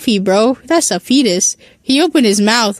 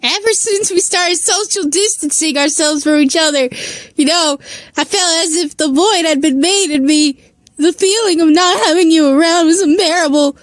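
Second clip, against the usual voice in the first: high, tearful confession; melodramatic, pitched-up weepy lament; voice cracking; 6 semitones higher than usual. A narrator's reading. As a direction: pour it out in an acted crying voice. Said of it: "voice cracking".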